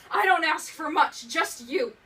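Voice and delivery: loud, high escalating shout; angry outburst. Acted. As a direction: burst out yelling in accusation. Re-enacts abuser's outburst in monologue.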